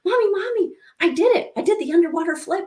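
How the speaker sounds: put-on high-pitched, excited little girl; imitating a thrilled kid, high and eager; excited child. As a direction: burst out with big news for Mommy. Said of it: "she was all excited".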